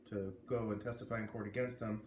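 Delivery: ordinary delivery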